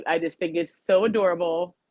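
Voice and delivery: usual speaking voice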